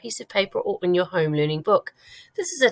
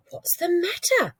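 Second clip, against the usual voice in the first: put-on little girl asking; 12 semitones higher than usual. A narrator's reading. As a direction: ask gently as a worried girl. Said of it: "Sophie asked".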